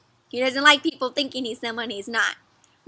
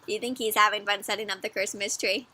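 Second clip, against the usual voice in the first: ordinary delivery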